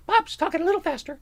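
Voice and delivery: squeaky sped-up Bob; sped-up record voice. Retold or imitated. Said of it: "the record played was just a little faster".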